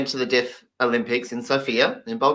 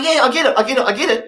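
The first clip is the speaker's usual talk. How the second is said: eager high yes-yes voice, lightly done; mock eager agreement; Finnish guy nodding along; 10 semitones higher, 5 dB louder, faster than usual. Finnish listener pretending to understand, eager mock agreement. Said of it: "So he's going".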